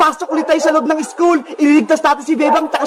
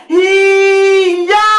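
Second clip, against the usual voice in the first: long, higher martial-arts yell; battle cry; 4 semitones higher, 4 dB louder than usual. Acted. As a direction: draw it out as you strike. Battle cry while attacking villain. (mahabang sigaw ng pag-atake)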